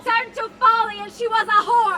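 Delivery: loud, bitter accusation; angry outburst. Acted. Husband shouting his charge at the maid, bitter. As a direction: lash out in self-justification. Othello defends the killing.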